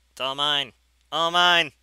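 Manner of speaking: loud and high, building on the repeat; escalating gleeful claim; greedy gloating. Acted. Gloating over grabbing mass in game.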